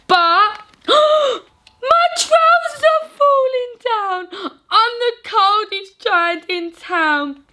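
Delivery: gasp, then high, slow, wailing; dismayed cry. Acted. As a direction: caught out as the trousers drop. Trousers fall mid-song.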